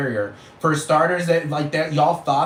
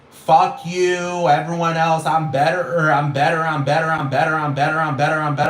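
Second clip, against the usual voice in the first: louder, chanting I'm better like a show-off; mock arrogance; 3 semitones higher, 5 dB louder than usual. Mocking loud music blasters as smug show-offs, louder chant. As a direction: lightly mimic a smug braggart. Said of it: "It's very fucking, like".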